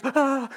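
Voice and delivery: high, loud imitated shriek of shock; mock scream. Preacher retelling Kung Fu Panda, panda's awed scream. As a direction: squeal like the startled panda. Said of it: "and he screams".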